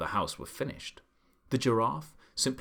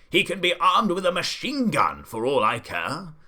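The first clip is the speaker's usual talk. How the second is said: higher, louder defiant roar; bellowed; 6 semitones higher, 7 dB louder than usual. A narrator's reading. The Duke defying the giraffe's warning, bellowing. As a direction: bellow it dismissively. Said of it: "bellowed the Duke".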